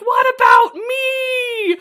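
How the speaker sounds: high, loud, drawn-out wail; stretched-out, indignant mock scream; mock shriek. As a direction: shriek it like a hammed-up drama queen. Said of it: "Sydney continues to shriek".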